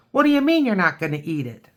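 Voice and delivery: fast playful character protest; indignant little creature. Pretend. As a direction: play a cartoon critter who can't believe it. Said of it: "this one is saying".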